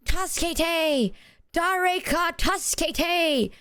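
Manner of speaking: slow, high Tasukete, Dareka tasukete in a mock Japanese accent; hammed-up Japanese-accented cry of Tasukete; put-on Japanese accent. Tourist begging strangers for help, slow high mock Japanese accent. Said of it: "not in that accent".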